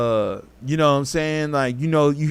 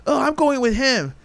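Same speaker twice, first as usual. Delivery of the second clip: mocking girl voice; 10 semitones higher than usual. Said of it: "you was like".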